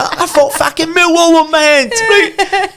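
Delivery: squeaking high through laughter; laughing disbelief. Retold or imitated. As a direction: quote him while cracking up. Sheringham shocked, teller laughing.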